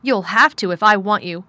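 loud, insistent comeback; bossy, forceful quarrelling; imperious retort. A narrator's reading. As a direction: lay down the law loudly. Children's quarrel, giving orders.

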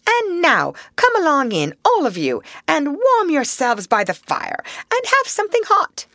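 high, hearty welcome; jovial, high-pitched host; hearty invitation. A narrator's reading. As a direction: usher them all in heartily. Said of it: "cried the Rat heartily".

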